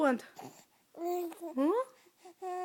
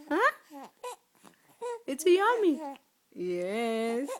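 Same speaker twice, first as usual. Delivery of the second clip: normal talking voice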